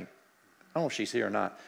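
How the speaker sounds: ordinary delivery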